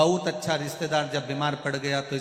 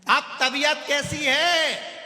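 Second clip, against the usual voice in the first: slow, much higher, loud question; shouted question; 12 semitones higher, 6 dB louder, slower than usual. Acted. Deaf visitor bellows at the patient in the hospital. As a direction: bellow it at the sick man.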